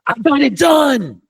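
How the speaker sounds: high, loud and slow; defiant shout. Retold or imitated. Kicker calling his coach back, defiant, shouting high. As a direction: yell it proudly, slowly. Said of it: "He's like".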